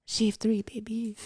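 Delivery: put-on French accent. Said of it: "do your best Frenchie from The Boys".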